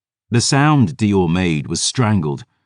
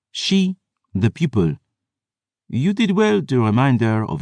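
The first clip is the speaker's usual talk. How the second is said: higher, smooth, measured aristocrat; suave French vampire; 4 semitones higher than usual. Acted. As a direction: speak like a sly French vampire. Voices the vampire historian throughout.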